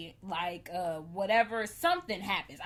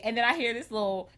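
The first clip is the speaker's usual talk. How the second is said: ordinary talk, nothing marked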